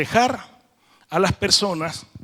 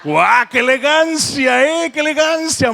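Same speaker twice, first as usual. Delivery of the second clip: delighted; 8 semitones higher, 5 dB louder than usual. Real, not acted. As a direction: gush with delight at the Catalan. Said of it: "Me ha encantado".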